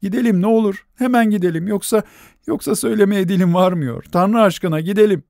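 high, breathless, begging; desperate plea. A narrator's reading. Nelli begging the doctor to come, high and desperate. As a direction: plead as if his life depends on it.

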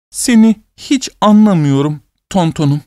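high, drawn-out tearful words. A narrator's reading. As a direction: stretch each word out high. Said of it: "kelimeleri uzatarak".

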